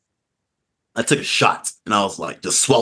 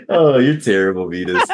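ordinary talk, nothing marked

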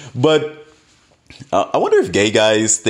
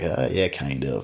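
sheepish admission; 6 semitones lower, 8 dB quieter than usual. Retold or imitated. Admitting he is new.

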